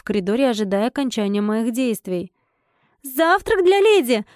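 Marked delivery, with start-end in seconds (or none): 3.0-4.3 high, drawn-out announcing call. A narrator's reading. Heroine announces breakfast to a lady, calling out. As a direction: call slowly into the room. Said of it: "крикнула я".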